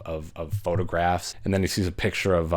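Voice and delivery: ordinary delivery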